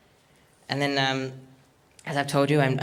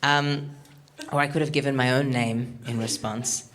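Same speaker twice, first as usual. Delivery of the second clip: ordinary talk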